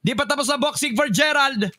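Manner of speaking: shouted hype play-by-play; esports caster. Real, not acted. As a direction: build the hype through the clutch. Caster calling the clutch round.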